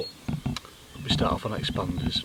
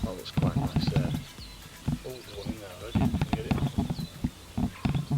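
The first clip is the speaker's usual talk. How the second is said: whispering; slower than usual. Real, not acted. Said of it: "Why am I whispering?".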